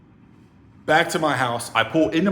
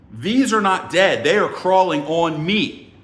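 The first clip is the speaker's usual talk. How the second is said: loud, high and slow, word by word; acted-out indignant objection; emphatic protest; 5 semitones higher, 6 dB louder, slower than usual. Visitor with bugs crawling on him, indignant. Retelling his protest to her.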